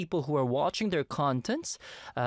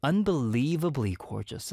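ordinary delivery

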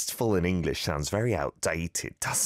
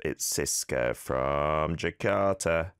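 normal talking voice

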